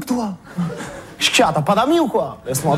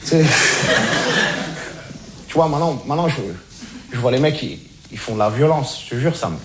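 much lower, softer, earnest voice; calmed-down sincere; 8 semitones lower, 3 dB quieter than usual. Acted. Reformed street guy shares his wise message, calm and low. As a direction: settle in and preach calmly. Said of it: "je me suis calmé".

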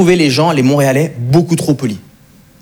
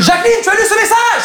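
shouting, pitch way up; all-caps shout. Retold or imitated. Old man texting a dead woman in all caps, high shout. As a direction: yell it like a text typed in capitals. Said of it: "un message en majuscule".

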